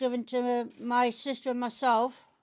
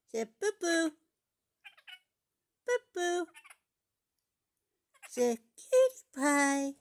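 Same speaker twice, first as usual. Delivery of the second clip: put-on pet baby talk; 4 semitones higher, 3 dB louder than usual.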